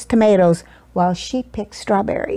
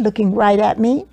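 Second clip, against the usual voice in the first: ordinary delivery, nothing marked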